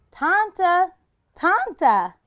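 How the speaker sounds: high and loud, calling far. Acted. Sadie calling for the old woman in the woods. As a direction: call into the forest. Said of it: "she called over and over".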